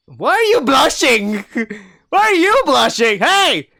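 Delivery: very high, loud teasing shriek with laughter; shrieked demand. Acted. Reader confronts the blushing wolf, shrieking in disbelief. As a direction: screech the question, breaking into laughter.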